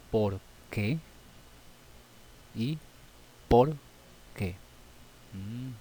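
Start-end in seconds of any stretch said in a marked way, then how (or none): 3.5-4.5 "por qué" split by a clear pause; teacher spacing out "por" and "qué"; separated words. Shown as a demonstration. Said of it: "Se pronuncian prácticamente muy, ¿no? idénticos".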